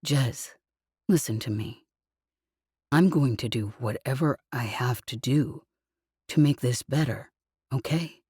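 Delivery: comforting murmur; tender low voice. Acted. He speaks softly, gently.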